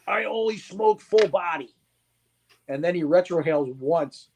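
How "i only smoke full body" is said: higher, mock tough-guy boast; imitating a macho smoker. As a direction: lightly mimic the customer bragging. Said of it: "I got a guy that goes".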